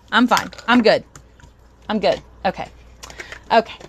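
ordinary talk, nothing marked

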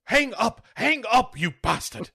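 very high, mock-desperate command; squealed put-on indignant voice; panicked villain. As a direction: plead shrilly for him to stop, hammed-up. Lithgow panicking on radio, acted.

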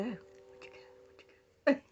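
whispered playful hide-and-seek; whispering peekaboo to a toddler. Acted. Peekaboo with baby.

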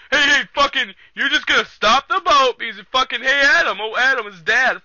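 high, loud exasperated outburst; mock angry caller. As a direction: imitate someone yelling to stop the boat. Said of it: "old guy's pissed".

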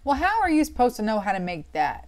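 loud, exasperated demand; genuinely mad. Real, not acted. Streamer fuming at an obscure recipe, loud angry. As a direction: fume at the game out loud. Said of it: "first time I've genuinely been mad".

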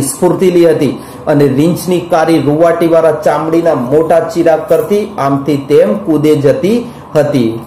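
ordinary talk, nothing marked

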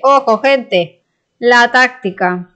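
slow, loud drawn-out call; drawn-out announcement. Pretend. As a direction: announce it like a showman. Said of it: "drawn-out announcement".

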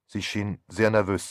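ordinary delivery, nothing marked

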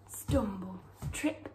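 ordinary delivery

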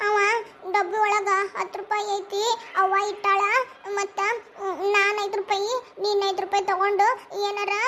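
halting, tearful confession; slow broken phrases with long sobbing pauses; crying. Acted. Child confesses taking money to father, crying. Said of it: "ಯಾಕ್ ಅಳಕತ್ತೀವ".

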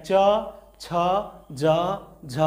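च, छ, ज, झ said slowly at the palate; palatal consonants. Shown as a demonstration. Teacher demonstrating palatal letters. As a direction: press the tongue to the palate for each. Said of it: "तालू में जीभ सीधा है".

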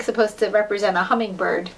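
ordinary delivery, nothing marked

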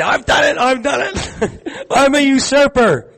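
put-on high squeaky child owning up, laughing; excited kid. Preacher voices a kid confessing in the room, high excited child voice. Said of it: "there's one there from the kid".

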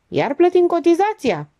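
high, playful needling question; good-humoured. A narrator's reading. Stratian ignores the engineer and asks him, in good humour. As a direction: ask it cheerfully, pitched well up. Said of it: "Se interesă bine dispus".

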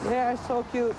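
high, loud gushing delight. Real, not acted. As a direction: beam with joy at the little ones. Delighted about the kids.

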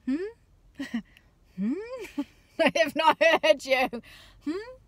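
hammed-up clueless child; fake-oblivious kid. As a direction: play dumb like a kid ignoring mum. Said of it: "And they're like".

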